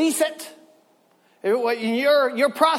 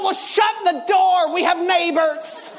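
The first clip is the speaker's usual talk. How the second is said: embarrassed yelled plea; 6 semitones higher than usual. Retold or imitated. Embarrassed kid hushing loud mother.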